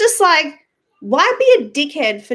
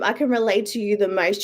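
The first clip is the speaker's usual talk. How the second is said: ordinary talk, nothing marked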